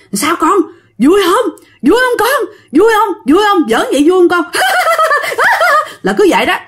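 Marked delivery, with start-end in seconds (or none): 0.1-4.4 high, gleeful spooky taunt; taunting ghost mother. Retold or imitated. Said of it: "nghe tiếng cái bà đó nói". 4.5-6.0 very high ghostly cackle; eerie cackle. Retold or imitated. Ghost mother laughs.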